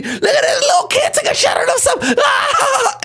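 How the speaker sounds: high squealed taunt into mock laughter; jeering schoolkids. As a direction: point and howl like a mocking kid. Acted kids jeering at him.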